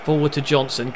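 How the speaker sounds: ordinary delivery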